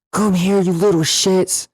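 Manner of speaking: high, slow mock yell at the kids; retold scream. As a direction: yell it like an angry man chasing kids. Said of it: "The dude actually screams".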